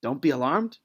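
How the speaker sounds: quiet, incredulous "Don't be alarmed?" with a questioning rise; doubtful soldier. Acted. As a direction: mutter "Don't be alarmed?" to yourself in disbelief. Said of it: "thinking to themselves".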